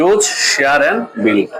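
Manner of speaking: Use, share and build, each word drawn out very slowly; slow emphatic list. Acted. Coach spells out the team's three basic tasks, very slowly. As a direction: lay the three words down one by one.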